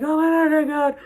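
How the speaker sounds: re-enacted cry of relief, high and loud; high, loud retold outburst; overwhelmed relief. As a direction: blurt it high and loud, overcome. Retelling finding friend at last.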